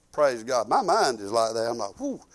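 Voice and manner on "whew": ordinary talk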